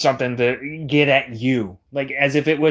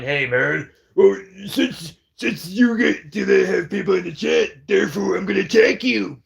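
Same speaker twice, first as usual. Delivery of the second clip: mock whiny voice; 4 semitones higher than usual. Mocking the other's reasoning.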